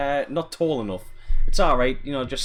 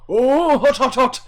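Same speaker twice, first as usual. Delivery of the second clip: high, loud, yelping "hot, hot, hot"; squealing like he's burning; Randy from South Park; 11 semitones higher, 8 dB louder than usual. Retold or imitated. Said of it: "Like, uh, Randy from South Park".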